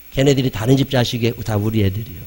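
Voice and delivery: ordinary talk, nothing marked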